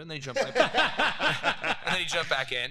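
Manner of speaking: laughing as the story lands; giggling through the words. Real, not acted.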